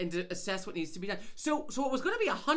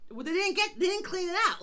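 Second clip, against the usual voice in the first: higher and louder, fed up; indignant complaint, raised voice; exasperated outburst; 10 semitones higher, 4 dB louder than usual. Real, not acted. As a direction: vent the frustration. Angry retelling of the repair.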